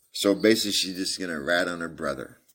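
usual speaking voice

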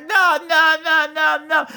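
high panicked pleading; imitating pleading victim. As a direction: beg as the terrified victim. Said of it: "he's like".

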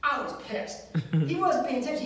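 normal talking voice

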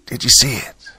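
a touch of hoarse, urgent whispering; raspy whisper. A narrator's reading. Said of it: "she whispered. Her voice was crackly and rasped".

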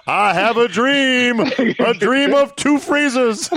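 high, slow, grand orator; hammed-up rousing speech delivery; mock speech. Mock civil rights oration.

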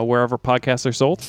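ordinary talk

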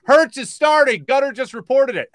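imitating a buddy's excited yell; yelling from another room. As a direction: holler the news through the house. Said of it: "yells out from the other room".

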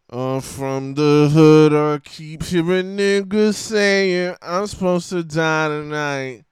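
slow, drawn-out rapper drawl. Acted. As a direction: drag every word like a mush-mouthed rapper. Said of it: "He had me talking like this".

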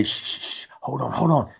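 whispered shh, then a soft hold on; imitated hushing whisper. Dad hushing his daughter in the blind, whispered. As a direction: hush someone quietly in a hunting blind. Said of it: "you can hear Dallas say".

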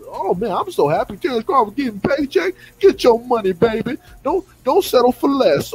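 high and loud, gushing fan parody; mocking devoted fan. Mocking fans who cheer their boxer's paycheck, loud and gushing. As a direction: ham up a fawning fan cheering the payday.

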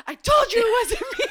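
laughing high vindication; vindicated stepmom. Retold or imitated. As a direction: stepmom finally cleared, high told-you-so. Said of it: "Priscilla is like".

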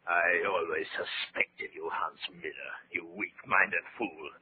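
slow, soft and scornful; slow, quiet menace toward a weak soldier; cold contempt. Acted. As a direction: lean in with quiet disgust. Officer scorns his comrade.

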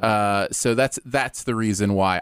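usual speaking voice

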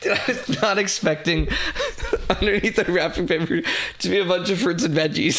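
high laugh breaking up the sentence. Real, not acted. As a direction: talk through helpless, surprised laughter. Surprised by the wrapped produce.

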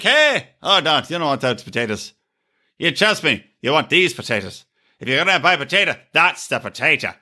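mock Irish potato salesman; Irish accent. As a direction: hawk it in a put-on Irish brogue. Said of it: "there was an Irish guy".

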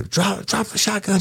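high, urgent police command; shouted command. Retold or imitated. Cop retelling yelling at the woman to drop her gun. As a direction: bark it at the armed woman. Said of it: "And then I'm telling her".

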